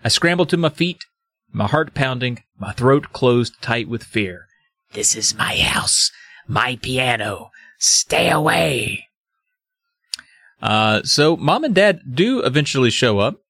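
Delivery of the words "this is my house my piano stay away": slow, whispered ghost warning; hushed spooky ghost voice; ghostly whisper. Retold or imitated. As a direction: hiss it slow like a ghost guarding her piano. Ghost repeats her warning.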